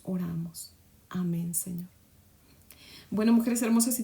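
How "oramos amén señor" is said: soft closing whisper; hushed end of the prayer; whispered amen. Real, not acted.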